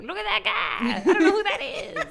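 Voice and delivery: playful pointing; Dewey impression. Cohost quoting Dewey in the credits scene, laughing.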